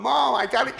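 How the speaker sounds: excited shout to mom. Retold or imitated. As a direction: call out to mom, thrilled. Excited call to his mom.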